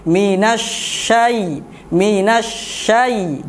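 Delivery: minasyai, sh sound let flow first; slow minasyai with breath flowing; flowing shin. Shown as a demonstration. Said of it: "dialirkan terlebih dahulu".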